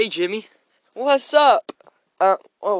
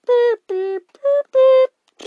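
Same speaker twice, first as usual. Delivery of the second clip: phone beeps; 13 semitones higher, much slower than usual. Acted. Dad dialing the tow truck, beeping.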